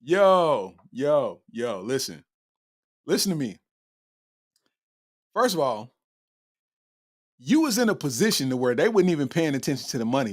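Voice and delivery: ordinary talk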